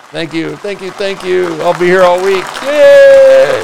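high comic's thank-you, building to a loud cheer; hammy club-comic farewell, rising to a cheer; mock stage sign-off. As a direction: take a pretend bow after the punchline. Mock comic's sign-off after joke.

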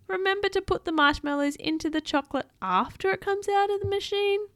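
high, fussy reprimand; scolding. A narrator's reading. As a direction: tell them off in a high storybook voice. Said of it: "she scolded".

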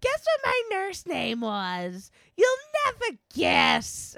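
slow, drawn-out tease going up high on "You'll never guess"; dragged out. Retold or imitated. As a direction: draw every word out, then float high. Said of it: "she dragged out every word".

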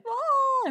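high-pitched mock wail of a child; child's wail. Mom imitates daughter told about shots, mock wail. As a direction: imitate a kid wailing in dread.

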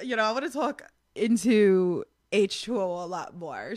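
ordinary talk

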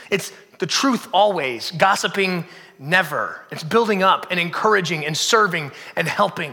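usual speaking voice

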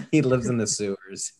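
laughing through the words. Real, not acted. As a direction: giggle while saying it. Joking about a homeless man.

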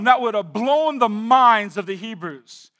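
normal talking voice

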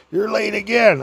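high, scolding church lady. Retold or imitated. Lady in the Datsun telling him he's late again. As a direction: needle him like a cranky old lady.

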